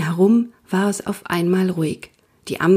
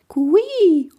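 owl begging call; 11 semitones higher than usual. A narrator's reading. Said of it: "machte einen leisen Bettellaut".

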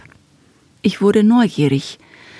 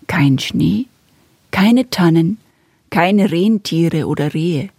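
ordinary delivery, nothing marked

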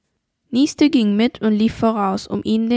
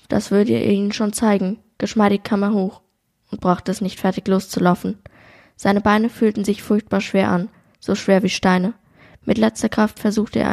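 steady, even read-aloud narration; story narration. A narrator's reading. Co-host reads the shooting scene aloud, calm narration. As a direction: carry the listener through the hunt scene.

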